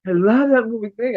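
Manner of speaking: high excited gush; high, gushing fan voice. Retold or imitated. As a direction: gush like a thrilled kid. Son's excited voice retold.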